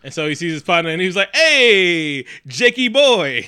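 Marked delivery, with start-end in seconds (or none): 1.3-3.4 loud, high, drawn-out mock greeting; hollered greeting. Partner hailing Jake in the retelling, hollered greeting. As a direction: call out a big playful hello, stretching it.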